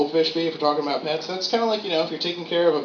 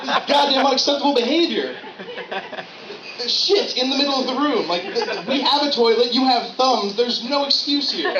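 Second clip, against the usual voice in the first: outraged yell; 7 semitones higher than usual. Retold or imitated. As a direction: yell at a filthy roommate. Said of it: "you'd be like".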